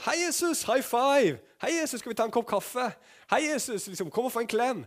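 high, bouncy buddy voice greeting Jesus; mock chummy greeting. Preacher mocks a casual buddy greeting Jesus, high and cheery. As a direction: play an overfamiliar pal slapping Jesus on the back.

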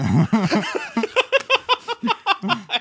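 high laughter. Real, not acted. Laughter over joke.

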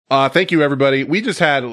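loud, high burst of gratitude; excited thanks. Real, not acted. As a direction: thank them with a big boost.

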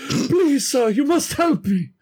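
high, pleading damsel voice; high swooning plea for help; dramatic plea. Acted. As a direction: swoon and beg melodramatically. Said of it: "be like".

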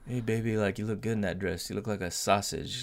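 hint of a sleazy old man; lightly voiced creepy catcall; leering old lecher. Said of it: "he does sound just like a dirty old man".

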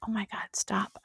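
whispered "Oh my God, stop" under the breath; exasperated whisper. Retold or imitated. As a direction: hush it out like pleading under the table. Exasperated at husband's comment.

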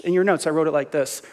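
usual speaking voice

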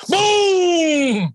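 a loud, high shouted boom for the rifle shot; imitated gunshot. Retelling the first rifle shot to his listener, loud shouted boom. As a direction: belt out the gunshot like it just went off.

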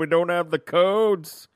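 high, loud, drawn-out wail; hammed-up collective alarm; mock panic. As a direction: stretch it out in put-on despair. Retold group panics over missing codes.

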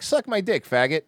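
high, crude mock radio host; lightly acted American shock-jock taunt. Host contrasting American shock jocks with Canadians, mock crude bravado. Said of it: "American shock jocks be like".

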